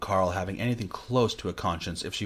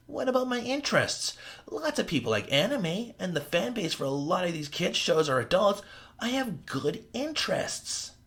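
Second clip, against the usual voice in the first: whiny Carl; 11 semitones higher than usual. Acted. Carl defending his hobby.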